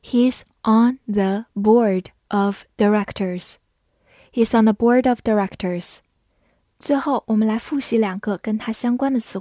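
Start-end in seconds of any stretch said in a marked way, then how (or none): 0.0-3.6 measured model sentence with gaps between words; slow reading. Shown as a demonstration. Teacher leading learners through the example, slow reading. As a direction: read slowly, word by word. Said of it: "慢慢来读".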